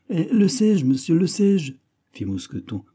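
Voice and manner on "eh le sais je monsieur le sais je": high, loud, helpless protest; flustered protest. A narrator's reading. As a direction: protest that you cannot possibly know. Servant pressed with questions, flustered. (protestation affolée, aiguë)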